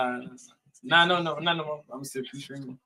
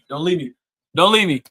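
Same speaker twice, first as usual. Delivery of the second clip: louder, insistent mock plea; mock desperate pleading; 3 semitones higher, 11 dB louder, faster than usual. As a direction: beg loudly, building on the repeat. Mock pleading with viewers to stay.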